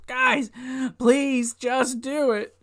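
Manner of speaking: hammed-up plea; mock pleading. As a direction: beg them in a put-on high voice. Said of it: "[name] just pleading at this point".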